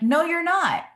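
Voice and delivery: snapped back high and loud; a mock heated comeback to her husband; sharp retort. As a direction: fire it back in a retold argument.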